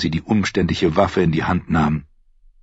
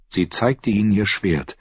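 plain reading voice

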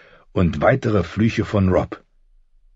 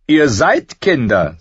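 slow, louder jeer, pitched up; mocking; 7 semitones higher, 5 dB louder, slower than usual. A narrator's reading. Theon jeering at the boys, mocking. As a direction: draw it out in loud mockery. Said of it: "sagte er spöttisch".

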